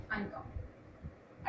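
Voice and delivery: slow, soft whisper. Shown as a demonstration. Teacher recapping for her students in a slow whisper. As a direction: breathe the word out slowly as a hushed recap. Teacher repeats answer after long pause.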